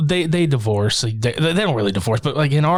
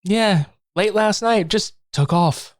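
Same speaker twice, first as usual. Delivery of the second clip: high, slow sly reply; slow mock-innocent high voice; sly mother; 8 semitones higher, slower than usual.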